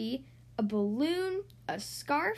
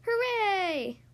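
cheering; 5 semitones higher than usual. A narrator's reading. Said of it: "All the children cried".

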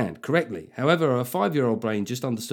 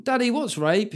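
put-on higher, innocent little kid question; child asking; 9 semitones higher, slower than usual. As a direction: ask it like a curious child.

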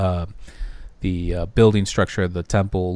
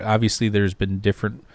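usual speaking voice

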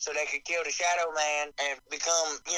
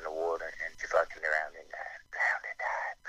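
breathy whisper, deadpan; whispered aside; 13 semitones lower, 5 dB quieter than usual. Acted. Mocking the princess's fate in a deadpan whisper. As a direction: drop to a whisper for the punchline. Mock mourning the drowned princess.

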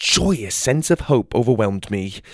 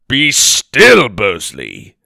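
scolding; 10 dB louder, much slower than usual. A narrator's reading. Theodore scolds Bursley for snapping at Gobley, loud reprimand. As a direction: silence him with a sharp scold. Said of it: "scolded Theodore".

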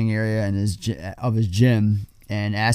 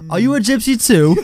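higher-pitched cheeky comeback; Ernie imitation; 10 semitones higher, 4 dB louder than usual. Host quoting Ernie answering Coach, cheeky. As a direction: toss it out high as a mocking retort. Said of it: "Ernie responds with".

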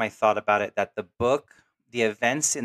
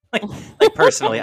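laughing; 11 dB louder than usual. Real, not acted. Laughing at own doubt.

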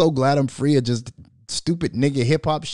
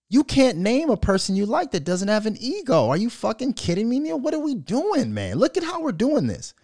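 exasperated protest; 6 semitones higher than usual. Retold or imitated. Host challenging his friend about ego, high and exasperated. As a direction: argue it out in rising exasperation. Acts out arguing with friend Neil.

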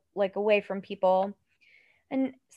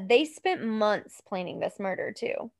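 ordinary delivery

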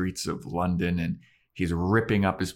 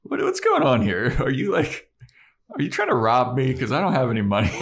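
high, loud, panicky mock alarm; 7 semitones higher, 5 dB louder, faster than usual. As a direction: squeak it like a startled mark. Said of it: "he's like".

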